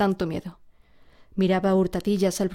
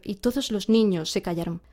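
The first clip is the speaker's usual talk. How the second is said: ordinary delivery, nothing marked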